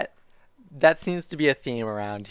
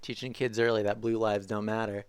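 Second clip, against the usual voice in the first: ordinary delivery, nothing marked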